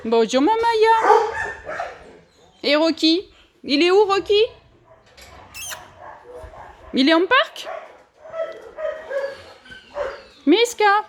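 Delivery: high, sing-song coo to each dog. Real, not acted. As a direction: coax the dogs like babies, high and bright. Greets more dogs in kennels.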